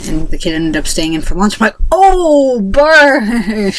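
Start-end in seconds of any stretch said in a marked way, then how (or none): 1.9-3.7 gloating. Retold or imitated. Gloating at bully's punishment.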